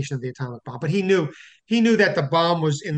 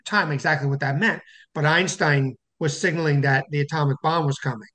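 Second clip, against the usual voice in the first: ordinary delivery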